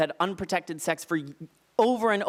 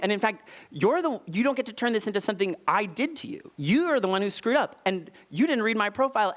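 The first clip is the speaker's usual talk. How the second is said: impassioned pushback; 7 semitones higher than usual. Retold or imitated. Heated lecture to scared date.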